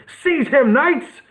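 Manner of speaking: high barked order; commanding. A narrator's reading. Nestor orders his knights to seize Nick, high commanding call.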